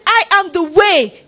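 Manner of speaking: "the" stressed in a loud, slow declaration. Shown as a demonstration. Jesus declaring he is the only way, firm stress on "the". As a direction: proclaim "the way" slowly, leaning on "the". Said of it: "the definite article".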